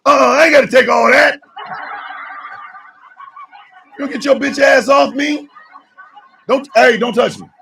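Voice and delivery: hammed-up defiant yell; mock angry pushback. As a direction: shove back in an argument. Acted pushback at aggressor.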